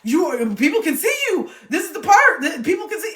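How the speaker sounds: high, loud, laughing inner voice; inner voice warning. Retold or imitated. Mocking the inner voice mid-breakdown. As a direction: scold yourself, amused. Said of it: "a part of you that is just like".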